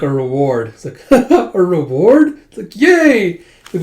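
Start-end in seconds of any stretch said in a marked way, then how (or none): 1.1-2.4 slow, loud, rising echo; delighted question. Retold or imitated. Zeno echoes it with delight. 2.8-3.4 high, loud squeal of delight; happy cheer. Retold or imitated. Zeno cheers at the reward.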